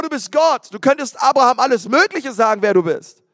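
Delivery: ordinary talk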